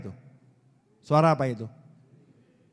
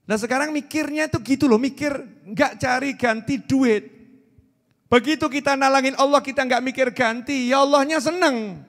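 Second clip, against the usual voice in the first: forceful preaching; 7 semitones higher, 3 dB louder than usual. Acted.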